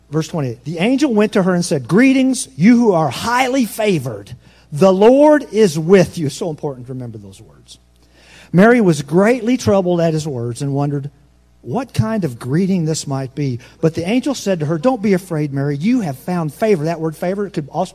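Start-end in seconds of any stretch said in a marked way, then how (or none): 0.6-6.3 high, loud, proclaiming Bible reading; loud scripture reading. Acted. Pastor reading Luke aloud to the church, loud proclamation. 8.5-16.8 loud scripture reading. Acted. Pastor reading Luke aloud to the church, loud proclamation.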